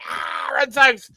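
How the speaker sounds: high, stretched-out fan yell; whooping mock scream, drawn out; retold celebratory scream. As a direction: re-enact screaming for the team in the street.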